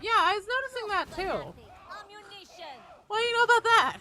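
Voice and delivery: high, loud and animated; excited high voice. Retold or imitated. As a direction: pitch it way up with excitement. Acting out own reply to doctor.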